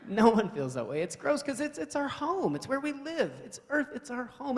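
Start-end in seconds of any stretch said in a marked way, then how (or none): none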